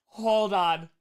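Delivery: big, showy stalling call; emphatic hold-on. Real, not acted. Repeats it louder while unboxing.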